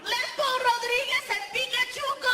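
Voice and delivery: squeaky mocking heckle out a car window; passersby yelling. Drivers passing the fake arrest, high falsetto mock yell. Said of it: "People are driving by going".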